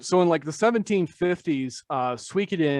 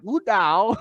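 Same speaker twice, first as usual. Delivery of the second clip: Lu Dao in a higher imitated Chinese accent; imitated Chinese pronunciation; 6 semitones higher, 4 dB louder than usual. Imitating a Chinese podcast guy saying Lu Dao, Chinese accent. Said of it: "this Chinese guy is, like, saying it".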